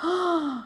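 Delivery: soft, sharp mock gasp; imitated shocked gasp. Girls all turning to look at him, shocked gasp. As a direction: gasp like a startled group. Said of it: "All the girls turn and look".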